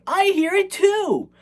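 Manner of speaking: hammed-up high kid impersonation; Billy. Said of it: "And then Billy's like".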